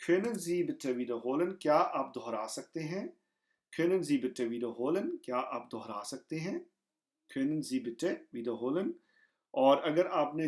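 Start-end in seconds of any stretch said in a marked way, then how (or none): none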